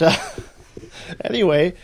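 usual speaking voice

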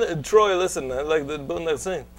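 lightly voiced Belgian accent pitching a film; a hint of Van Damme selling his idea. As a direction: Belgian accent, broken English pitch to a director. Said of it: "That's what the meeting would sound like".

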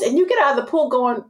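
usual speaking voice

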